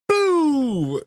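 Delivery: high, drawn-out "boo" launch noise; playful sound effect of a ball flying; imitated dodgeball throw. Dad pretending to dodgeball his son, playful high whoosh.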